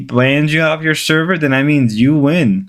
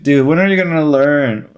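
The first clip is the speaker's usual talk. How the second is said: ordinary talk, nothing marked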